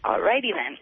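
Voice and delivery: ordinary delivery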